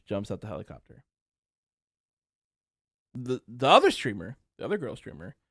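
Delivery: ordinary delivery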